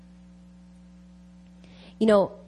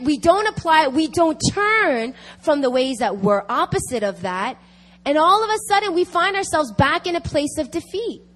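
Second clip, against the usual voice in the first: raised, higher-pitched sermon delivery; impassioned preaching; 7 semitones higher, 5 dB louder than usual. Real, not acted. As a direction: preach it urgently to the congregation.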